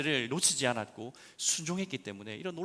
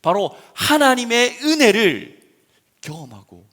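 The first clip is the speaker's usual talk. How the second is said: loud, high fervent preaching; preaching at full force; 9 semitones higher, 16 dB louder than usual. Acted. Pastor proclaiming grace to the congregation, fervent loud preaching. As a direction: proclaim it to the whole church.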